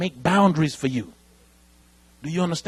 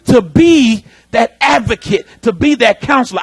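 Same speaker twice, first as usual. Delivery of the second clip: loud, slow, higher preaching cry; preaching shout; 6 semitones higher, 8 dB louder than usual. Real, not acted. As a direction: drive the promise home.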